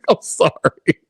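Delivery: very high burst of laughter into "I'm sorry"; real laughter at his own slip; laughing apology. Real, not acted. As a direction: crack up and apologize. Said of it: "I had to laugh at this shit".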